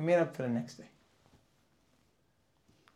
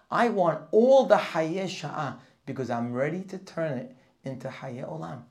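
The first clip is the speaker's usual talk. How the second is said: building from steady to loud and fervent; rising determined declaration; 3 semitones higher, 7 dB louder than usual. Acted. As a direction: proclaim it with growing conviction, louder and louder. A person declaring resolve to God.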